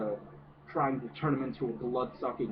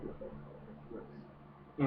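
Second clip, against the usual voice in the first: whispered slowly in disbelief; stunned whisper; 10 dB quieter, slower than usual. Retold or imitated. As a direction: breathe it out, too shocked to voice it. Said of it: "And I'm just like".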